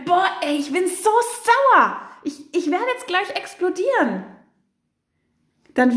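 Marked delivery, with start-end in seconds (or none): 0.0-4.2 real anger in the voice. Shown as a demonstration. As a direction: let the anger burst through, close to exploding. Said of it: "dann wird man das natürlich in deiner Stimme hören".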